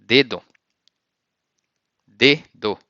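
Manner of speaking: dedo as DE-do, firm and falling; Portuguese dedo. Shown as a demonstration. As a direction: model word dedo, spoken out. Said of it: "you say".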